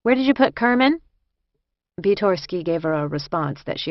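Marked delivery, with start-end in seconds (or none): 0.0-1.0 higher, louder businesslike question; all business. A narrator's reading. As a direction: demand it with brisk authority. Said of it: "all business".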